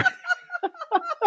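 breaks into high laughter; can't finish for laughing. Real, not acted. Laughs at own story.

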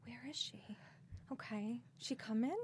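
hushed, worried muttering to herself; retold frantic whisper; anxious whisper. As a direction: whisper it like you're alone and scared. Retold anxious thoughts while waiting.

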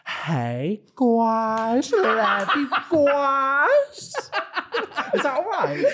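hammed-up gushing love you guys; camp greeting. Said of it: "It's giving A S M R".

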